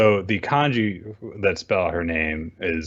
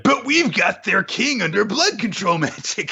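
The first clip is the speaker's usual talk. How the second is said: mock Asta, much higher pitch; a naive hero chirping up with a much higher voice; 13 semitones higher than usual.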